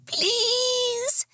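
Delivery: very high pleading pet voice; begging dog voice. Acted.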